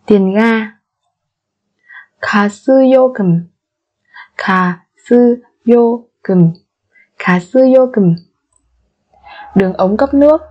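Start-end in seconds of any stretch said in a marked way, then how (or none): 4.4-6.5 slow, separated syllables for learners; careful teacher's slow model. Shown as a demonstration. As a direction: spell it out slowly, beat by beat.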